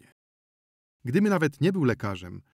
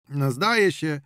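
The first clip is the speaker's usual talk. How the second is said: louder, formal, by-the-book; official tone; 4 semitones higher, 6 dB louder than usual. Acted. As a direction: speak like a clerk on duty. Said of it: "urzędowym tonem powiedział ziomek". (urzędowym tonem)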